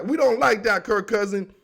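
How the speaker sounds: usual speaking voice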